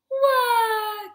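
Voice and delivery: loud, high storybook bawling; acted wail. A narrator's reading. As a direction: bawl like a sore loser. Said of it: "Goosey wailed".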